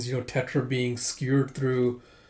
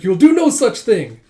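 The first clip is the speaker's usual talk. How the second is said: loud, high, scandalized protest; indignant refusal; 8 semitones higher, 12 dB louder than usual. Acted. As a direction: forbid the visitor outright.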